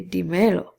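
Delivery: "dímelo" stressed on the middle syllable, di-ME-lo; stress on next-to-last syllable. Shown as a demonstration. Teacher shows where unaccented word falls, "dímelo" stressed on ME. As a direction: move the stress in "dímelo" onto ME. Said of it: "emphasis is gonna be on the next to last".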